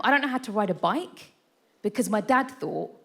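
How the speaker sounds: usual speaking voice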